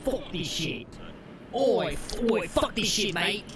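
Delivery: high, loud cockney-ish shouting at the course; hammed-up angry cockney rant; angry mock British yell. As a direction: yell it like a furious London bloke. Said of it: "I really goes".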